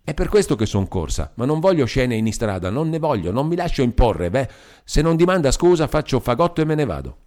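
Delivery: mild huffy defiance; Palmira, defiant. Acted.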